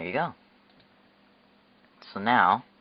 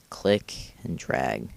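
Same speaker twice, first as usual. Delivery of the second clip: slow, deliberate instruction; 6 semitones lower, much slower than usual. Shown as a demonstration. Repeating the instruction slowly.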